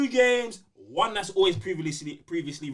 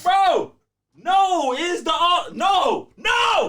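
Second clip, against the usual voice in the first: shouted no; 8 semitones higher, 8 dB louder, much slower than usual. Real, not acted. Shouting back at S1.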